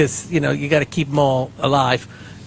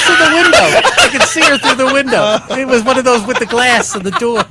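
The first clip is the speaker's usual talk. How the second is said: high, louder protest through laughter; laughing protest; 7 semitones higher, 3 dB louder than usual. Real, not acted. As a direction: yell the excuse while laughing. Defending himself laughing.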